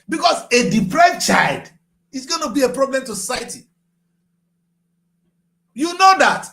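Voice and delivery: loud impassioned plea; emphatic shout. Real, not acted. Warning about deprived children.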